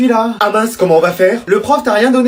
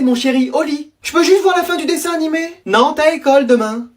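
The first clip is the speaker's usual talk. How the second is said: usual speaking voice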